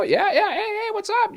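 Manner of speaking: high, eager hello; excited phone greeting. Retold or imitated. Young man picking up his girlfriend's call, high and eager. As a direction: jump up in pitch, keen to talk.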